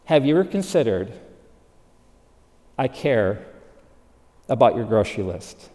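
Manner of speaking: soft, low and tender, barely above a murmur; gentle divine voice. Acted. The Holy Spirit gently answering a frustrated woman, soft and low. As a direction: speak it as a loving whisper to the heart.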